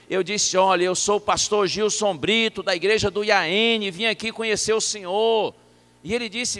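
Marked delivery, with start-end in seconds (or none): 0.6-5.5 raised voice, pitched up; high-volume talk. Retold or imitated. Visitor introducing himself to a near-deaf pastor, high-volume talk. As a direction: speak up loudly for a deaf listener. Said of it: "de alto volume".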